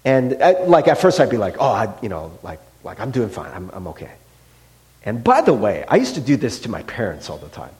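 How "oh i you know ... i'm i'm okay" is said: low, very soft, halting denial; evasive mumble. Retold or imitated. Husband dodging his wife's question, low and evasive. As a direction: trail down into a soft, unconvincing reply. Evasive first answer to wife.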